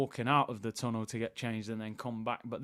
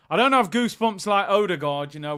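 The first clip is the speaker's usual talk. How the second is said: normal talking voice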